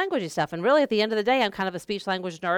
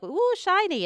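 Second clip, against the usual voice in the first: distracting a toddler; 12 semitones higher, slower than usual. Distracting a two-year-old.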